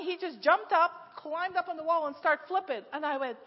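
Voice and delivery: ordinary talk, nothing marked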